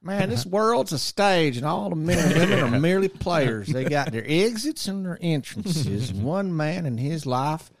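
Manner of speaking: put-on country accent. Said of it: "a country accent than what you think".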